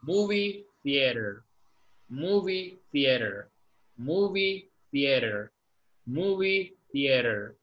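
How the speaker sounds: "Movie theater" said slowly, stress on movie; slow pronunciation drill. Shown as a demonstration. Teacher helps student pronounce a hard word. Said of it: "Le ayudo a pronunciar esa palabra".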